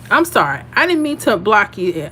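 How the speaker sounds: ordinary talk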